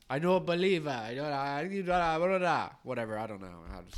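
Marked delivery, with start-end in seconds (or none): 0.1-2.7 lightly attempted Mexican accent breaking into gibberish; badly faked Mexican accent, fumbling into blahs; mock Mexican. Joke teller voices the doubting Mexican, faltering mock Mexican accent.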